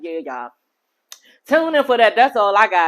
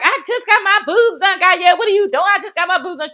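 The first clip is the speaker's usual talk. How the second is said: high-pitched, indignant mock whine; mimicked protest; 8 semitones higher than usual. Host imitating Florence pushed after surgery, mock protest. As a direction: imitate her protesting in a much higher voice.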